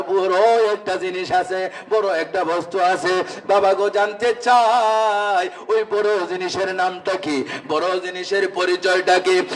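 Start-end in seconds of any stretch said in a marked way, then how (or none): none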